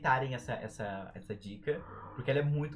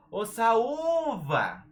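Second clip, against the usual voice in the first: loud, high, drawn-out call; 9 semitones higher, 9 dB louder, much slower than usual. Shown as a demonstration. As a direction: holler for someone, stretching the U in Saúva. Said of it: "bate palma e manda um".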